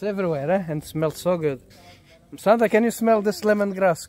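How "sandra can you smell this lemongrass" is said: higher and louder, calling across the garden; calling Sandra over to smell the lemongrass, raised voice. Real, not acted. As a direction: call her over to smell it.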